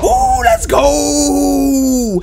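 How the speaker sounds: drawn-out high victory shout; excited cheer. Real, not acted. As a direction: stretch it out in triumph. Clutch win celebration.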